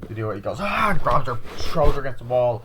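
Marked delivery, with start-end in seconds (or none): 0.6-0.9 high, loud mock scream; imitated yell. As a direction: act out a lunging yell.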